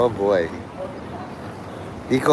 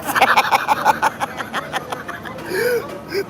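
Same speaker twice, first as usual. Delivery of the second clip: a long high burst of laughter; 4 dB quieter than usual. Real, not acted. Streamer laughs hard at the crowd, high burst of laughter. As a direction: crack up at the scene.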